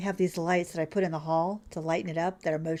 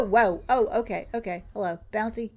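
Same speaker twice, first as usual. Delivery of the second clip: ordinary delivery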